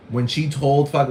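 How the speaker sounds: usual speaking voice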